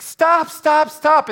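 high, loud pleading cry; frantic shout. Acted. Girlfriend trying to stop the fight, frantic shout.

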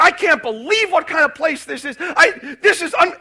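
loud, high retold rant at the clerk; loud, high, furious customer losing it; angry outburst. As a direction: blow up at the shop lady, really loud. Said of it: "I just flew off the handle".

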